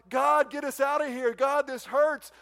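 acted desperate plea, pushed up and out; imitated groaning plea. Enslaved Israelites begging God for rescue, mock groaning. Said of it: "They've been groaning for two hundred years".

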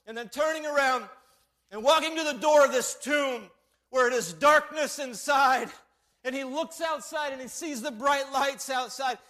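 high, loud, building proclamation; impassioned preacher picturing the scene; full-force preaching. Real, not acted. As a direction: drive the sermon home at full voice.

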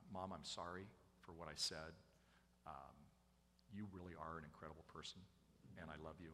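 low, hushed, sincere apology; tender apology. Retold or imitated. Daughter apologizing to mom, tender and quiet. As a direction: softly own up and show affection.

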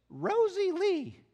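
very high, loud, drawn-out name; real high-pitched voice. Retold or imitated. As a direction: announce it slowly in a piping voice. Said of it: "in this real high-pitched voice".

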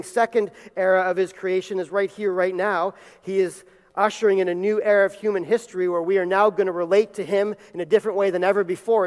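ordinary talk

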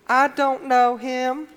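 put-on high, slow, whiny kid voice, I stretched out; mock a sulky child denying it; preacher mimicking how the kids in church would deny it; imitating kids. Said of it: "That's how you kids would say it".

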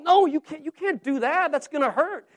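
high, alarmed no, you can't; frightened protest. Retold or imitated. Ghost protests the sword.

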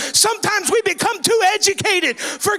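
loud, driving revival preaching; full-force preaching. Real, not acted. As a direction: cry it out to the congregation, stretching key words.

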